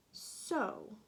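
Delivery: ordinary delivery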